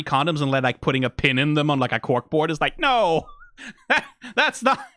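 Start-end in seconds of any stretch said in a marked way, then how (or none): 2.8-4.8 high-pitched, incredulous mock outrage; mock-appalled objection, pitched way up; exasperated protest. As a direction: protest as if appalled, half laughing. Mock outrage at condom pinning.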